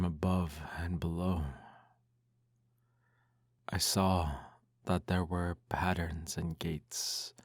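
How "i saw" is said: usual speaking voice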